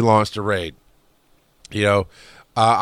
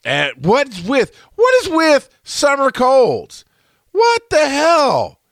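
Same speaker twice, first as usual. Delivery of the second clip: exasperated; 4 dB louder, slower than usual. Real, not acted. Exasperated about summer colds.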